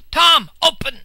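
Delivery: loud pleading yell; desperate shout. Acted. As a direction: shout it through the door. Man calls desperately at door.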